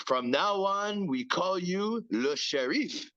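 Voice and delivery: high, slow French accent imitation. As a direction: do the bar owner's thick French accent. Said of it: "it's this thick French accent".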